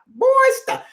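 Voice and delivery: boy stop with a hint of a big voice. To the chat room, a lightly done big boy voice. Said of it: "In my big boy voice".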